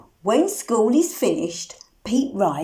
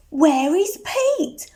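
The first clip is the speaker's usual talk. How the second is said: usual speaking voice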